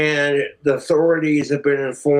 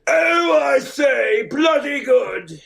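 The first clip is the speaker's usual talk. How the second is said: a hammed-up toff, slow on bloody good; posh British impression; 12 semitones higher, slower than usual. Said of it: "I mean, I'm just saying".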